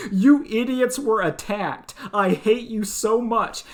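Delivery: loud high mock outrage at the girl; mock angry rant. Mocking the hero yelling at the girl, hammed-up loud outrage. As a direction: rant at her like a petulant hero. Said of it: "he's like".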